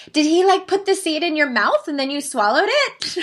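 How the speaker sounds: put-on very high, loud little-girl impersonation on the edge of laughter; six-year-old daughter. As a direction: mimic an incredulous child in a squeaky-high voice. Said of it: "she looks at me and she goes".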